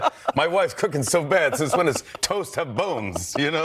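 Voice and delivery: Rodney Dangerfield impression. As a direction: lean on the punchline like Rodney. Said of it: "I would do Rodney impressions".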